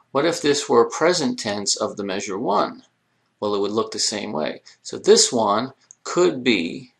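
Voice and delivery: normal talking voice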